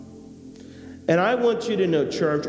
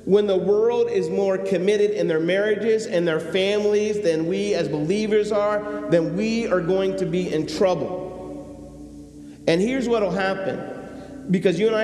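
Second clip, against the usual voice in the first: preaching; 5 semitones higher than usual. Acted. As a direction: press each warning home to the church. Preaching to the church.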